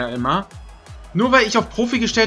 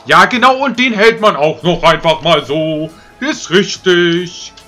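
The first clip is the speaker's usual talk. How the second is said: sarcastic rage; 2 semitones lower, 5 dB louder than usual. Real, not acted. Sarcastic rage at keeper save.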